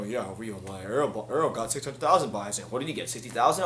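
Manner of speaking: mocking a trainer who sounds like a suit; mock businessman. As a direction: send up his cold money talk. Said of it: "talk like a businessman".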